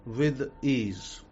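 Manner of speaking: slow, soft, spaced-out affirmation; nonchalant, neutral. Shown as a demonstration. Coach showing students the right way, slow and spaced out. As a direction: say it calmly like you have all the time in the world. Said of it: "is a nonchalant, neutral spaced out way".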